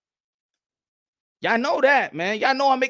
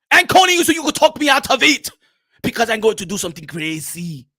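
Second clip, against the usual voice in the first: African boxer's urgent plea, dropping off; plead, then turn ominous; agitated African-accented warning; Makabu; 4 semitones higher, 8 dB louder than usual. Acted.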